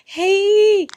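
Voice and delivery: high, loud, drawn-out excited hey; super excited. Acted. Excited partner greeting her partner, super excited. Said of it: "Imagine you being super excited".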